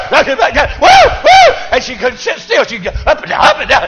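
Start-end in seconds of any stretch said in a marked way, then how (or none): 0.8-1.5 put-on very high, loud girlish whoops; little girl's whoops. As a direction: imitate a girl shouting for joy.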